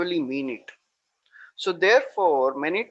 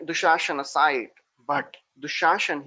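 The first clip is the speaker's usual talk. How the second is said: usual speaking voice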